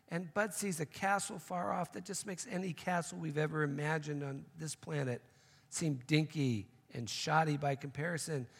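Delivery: ordinary talk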